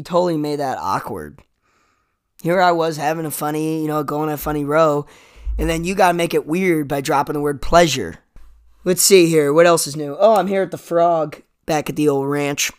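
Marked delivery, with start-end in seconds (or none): none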